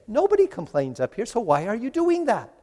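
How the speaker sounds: acted reproach. Preacher voicing Jesus chiding complainers, high mild reproach. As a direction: chide them, pitch climbing on the question. Voices heaven scolding complainers.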